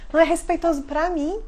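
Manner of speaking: ordinary talk, nothing marked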